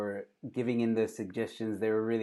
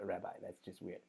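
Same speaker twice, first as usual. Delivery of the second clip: ordinary talk, nothing marked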